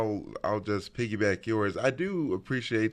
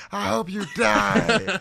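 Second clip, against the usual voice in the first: high, loud, drawn-out mock curse; mock kid tantrum; 10 semitones higher, 6 dB louder, slower than usual. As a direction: overdo it like a kid denied a toy. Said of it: "sometimes they go overboard".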